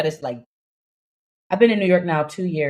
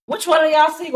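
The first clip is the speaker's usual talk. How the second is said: high, loud, hammed-up drunk guy; drunk man; 12 semitones higher, 3 dB louder than usual. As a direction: play the tipsy stranger calling out.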